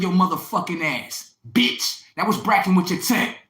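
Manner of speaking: escalating rant. Retold or imitated. Boyfriend yelling down his girlfriend, building loud. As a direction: lay into her harder with every line.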